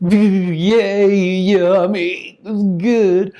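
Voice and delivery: high, loud gleeful squeal over food; hammed-up happy foodie; childlike delight. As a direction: bounce in the chair with joy. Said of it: "in my chair, just going".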